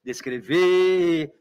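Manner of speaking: "descrever" with a long drawn-out VE at the end; des-cre-VEEE, testing the stress at the back; stress on the last syllable. Shown as a demonstration. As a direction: hold the final syllable of "descrever". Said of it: "Eu vou até a última sílaba tônica".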